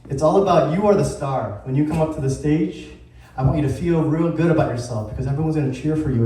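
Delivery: ordinary talk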